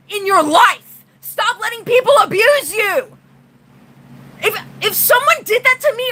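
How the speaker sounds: high, strained shouting at men; real angry outburst, voice cracking; furious rant. Real, not acted. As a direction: yell it out, fed up and furious. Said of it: "I'm really pissed off".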